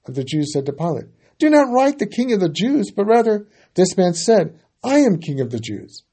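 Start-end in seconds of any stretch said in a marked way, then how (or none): 1.4-6.0 loud, much higher protest; priests protesting. A narrator's reading. Chief priests protesting to Pilate, loud high protest. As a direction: object with high insistent force. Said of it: "chief priest said".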